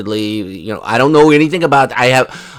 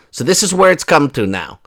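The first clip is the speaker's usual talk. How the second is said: normal talking voice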